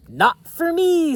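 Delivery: high, loud brush-off; cocky racer voice. Acted. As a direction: toss it off like a proud speedster. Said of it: "said Red".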